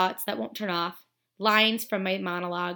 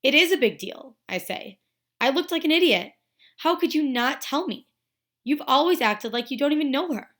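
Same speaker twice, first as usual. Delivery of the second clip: higher, louder, indignant reproach; annoyed; 6 semitones higher, 5 dB louder than usual. A narrator's reading. Friend confronts Olivia about not telling, annoyed. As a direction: confront a friend who kept something from you. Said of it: "I'm really annoyed at her for not telling me this".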